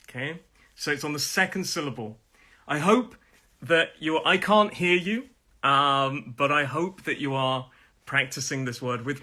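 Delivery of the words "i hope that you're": ordinary talk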